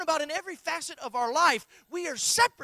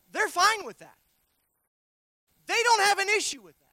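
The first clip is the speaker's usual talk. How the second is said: louder, rising pulpit emphasis; preaching build; 4 semitones higher, 6 dB louder than usual. Acted. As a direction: lift it and lean into it.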